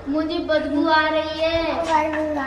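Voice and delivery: talking with the nose pinched shut; pinching nose. Shown as a demonstration. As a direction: hold the nose closed and say it. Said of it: "नाक बंद करके बोलो".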